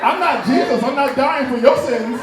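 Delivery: indignant refusal. Retold or imitated. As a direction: protest loudly to a freeloading dad. Continued outraged refusal to dad.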